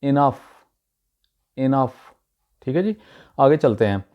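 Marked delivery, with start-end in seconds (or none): 0.0-0.4 इनफ starting with a short 'i', i-NUF; correct pronunciation. Shown as a demonstration. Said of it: "actual इसका तलफ़ुज़ है". 1.6-1.9 इनफ starting with a short 'i', i-NUF; correct pronunciation. Shown as a demonstration. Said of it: "actual इसका तलफ़ुज़ है".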